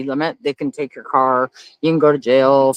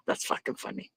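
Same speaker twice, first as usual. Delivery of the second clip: laughing; 5 semitones higher, 5 dB quieter than usual. Real, not acted. Laughing at a comment.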